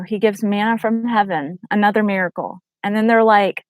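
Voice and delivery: ordinary talk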